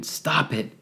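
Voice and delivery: high, loud desperate yell. A narrator's reading. Wesley yelling at Blue to leave the girl, desperate yell. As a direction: yell it out of the shadows. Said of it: "yelled again".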